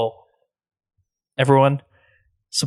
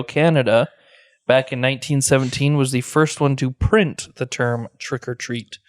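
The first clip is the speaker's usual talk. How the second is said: usual speaking voice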